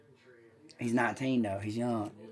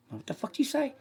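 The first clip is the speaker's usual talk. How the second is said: high, softer mock voice; imitating Billy; 13 semitones higher, 9 dB quieter than usual. Crackhead mumbling at the teller, high and soft. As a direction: squeak it like the mumbling guy. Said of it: "he kept trying to whisper to me".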